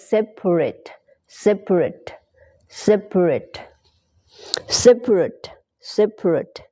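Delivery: "separate" repeated crisply, stressed on "sep"; demonstrated pronunciation. Shown as a demonstration. Teacher saying "like this", repeated pronunciation drill. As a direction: drill "separate" with a strong first syllable and pauses between. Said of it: "แบบนี้นะ".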